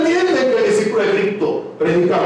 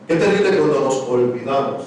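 normal talking voice

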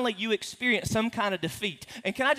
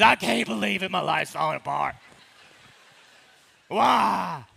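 overreacting; 4 dB louder than usual. Overreacting to onions on order.

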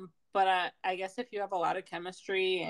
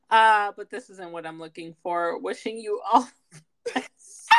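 formal, louder rejection script; polite brush-off; 3 semitones higher, 6 dB louder than usual. Pretend. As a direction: recite a polite dump text. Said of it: "Come on, [name], go ahead".

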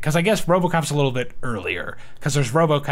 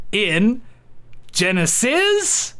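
slow game-show announcement; 3 semitones higher, slower than usual. Acted.

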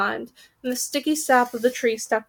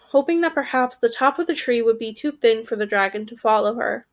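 plain reading voice

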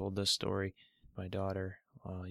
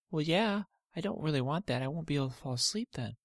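put-on much higher child voice, worried; little girl Olivia; 6 semitones higher, faster than usual. Olivia telling her dad she fears a sleepless night, high and worried. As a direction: play a fretful small girl.

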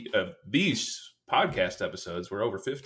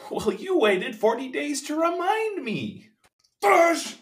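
high crying voice; 6 dB louder, slower than usual. Acted. Acted sobbing character voice.

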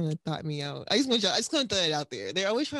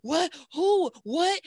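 higher, louder frantic questions, one after another; frantic fangirl; 8 semitones higher, 4 dB louder, much slower than usual. Retold or imitated. Friends call with the star on the line, frantic, excited. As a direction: lose it like a fan hearing her idol is there. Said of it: "I'm like".

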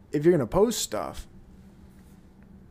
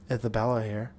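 soft, lower mock feminine alter; a hint of a put-on alter voice, lower and softer; faked alter switch; 5 semitones lower, 6 dB quieter than usual. Shown as a demonstration. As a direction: drop into a hushed new persona. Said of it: "Did you see that switch?".

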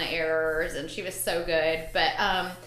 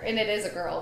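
ordinary talk

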